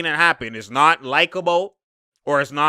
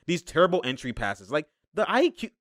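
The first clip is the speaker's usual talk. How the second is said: usual speaking voice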